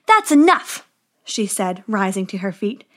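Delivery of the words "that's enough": loud, high-pitched princess's outburst; sharp, high cry of defiance; indignant command. Acted. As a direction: put a stop to it, voice raised.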